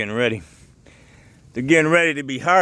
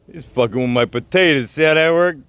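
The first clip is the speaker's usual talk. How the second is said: ordinary delivery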